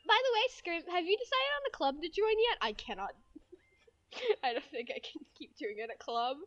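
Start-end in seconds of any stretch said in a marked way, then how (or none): 0.1-2.6 high, perky schoolgirl; anime girl. Acted. Friend asks about joining a club, high anime girl voice. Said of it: "a regular anime girl voice".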